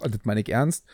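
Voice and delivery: normal talking voice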